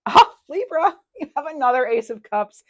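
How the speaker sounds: thrilled announcement; excited. Real, not acted. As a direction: squeal the good news to the viewer. Thrilled at another Ace.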